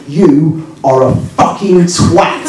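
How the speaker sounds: very slow, word-by-word verdict; deliberate insult. Retold or imitated. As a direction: spell out the insult slowly. Man grips him and delivers insult.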